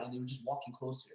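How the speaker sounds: ordinary delivery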